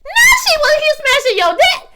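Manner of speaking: loud, slow, stretched-out outrage at the screen; shouted disbelief. Retold or imitated. Shouting at the TV over the twist, slow and outraged. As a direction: yell each word in shock. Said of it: "I said".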